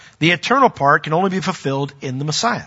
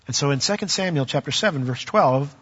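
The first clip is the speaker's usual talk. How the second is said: normal talking voice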